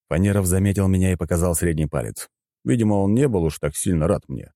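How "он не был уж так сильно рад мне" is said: plain reading voice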